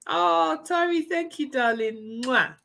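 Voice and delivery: high, cooing thank-you with a kiss; sweet high-pitched affection; gushing thanks. Real, not acted. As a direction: coo gratefully to a dear friend. Thanks a viewer, blows a kiss.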